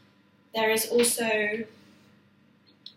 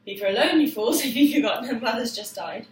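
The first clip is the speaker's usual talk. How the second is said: loud line reading; 6 dB louder than usual. Shown as a demonstration. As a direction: read it out loud and strong. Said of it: "I'll read them aloud first".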